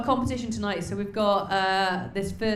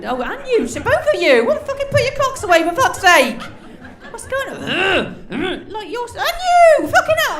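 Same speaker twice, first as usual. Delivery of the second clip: much higher, much louder comic disgust; mock outraged shout; 14 semitones higher, 11 dB louder than usual. Host scolding men in the front row, hammed-up outraged shout. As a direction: scold them like an appalled aunt.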